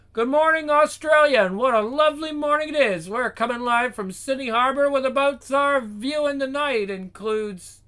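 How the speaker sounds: high, loud broadcast greeting; breakfast TV presenter. Acted. Presenter greeting Australia from Sydney Harbor, high and loud. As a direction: project it like a live morning show host. Morning TV host voiced.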